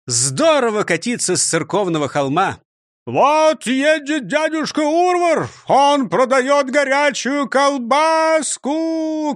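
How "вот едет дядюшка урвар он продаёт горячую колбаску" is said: high, slow, loud calling out; shouting. A narrator's reading. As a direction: call it out to the street. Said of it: "кричал Урвар".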